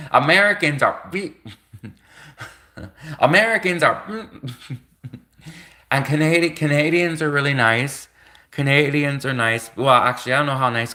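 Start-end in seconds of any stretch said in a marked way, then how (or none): 0.0-6.5 laughing. Real, not acted. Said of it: "laughing while trying to explain".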